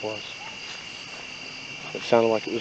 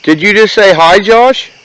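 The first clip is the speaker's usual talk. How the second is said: startled; 8 semitones higher, 15 dB louder, slower than usual. Real, not acted. Startled by a ghost voice.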